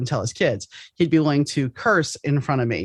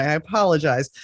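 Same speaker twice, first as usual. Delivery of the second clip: ordinary talk, nothing marked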